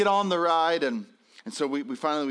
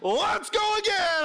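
very high, drawn-out cheer; imitated child whooping for more; excited kid; slower than usual. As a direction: squeal it slowly and gleefully. Excited son wants another ride.

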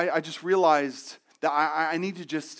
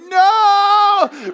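high, loud cry of protest; teen grandson's horrified yell; mock horrified shout; 15 dB louder than usual. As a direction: yell it like watching a disaster. Narrator's horror as grandpa kisses girlfriend.

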